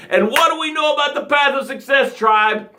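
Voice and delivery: pumped-up shout to the tribe; rallying call. Real, not acted. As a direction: fire up the tribe with a big call.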